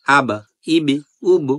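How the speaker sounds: আব, ইব, উব with the final ব bounced out hard; qalqala bounce. Shown as a demonstration. Teacher demonstrating the qalqala bounce on ব. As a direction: jolt the closing ব on আব, ইব, উব. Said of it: "ধাক্কা দেওয়ার চেষ্টা করব".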